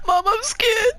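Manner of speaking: very high, mock-tearful squeal; imitated scared child. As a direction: wail to mum like a frightened little kid. Child phoning mum, frightened.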